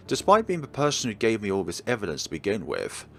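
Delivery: ordinary delivery, nothing marked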